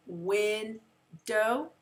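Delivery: "Window" drawn out in two parts, win-dow; split into syllables. Shown as a demonstration. Teacher checking pupils' syllable split, "Window" in two parts. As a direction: say "Window" slowly with a gap after win. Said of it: "to separate our two syllables".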